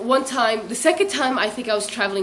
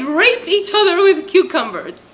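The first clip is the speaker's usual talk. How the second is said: much higher, louder, slowed down; loud mock blurt; 9 semitones higher, 8 dB louder, slower than usual. Imitating how loudly she finished the rumour, loud mock blurt. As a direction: belt it out, hammed-up and slow. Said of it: "and then loudly said".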